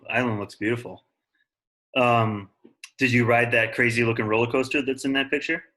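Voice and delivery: ordinary talk, nothing marked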